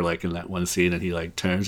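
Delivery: usual speaking voice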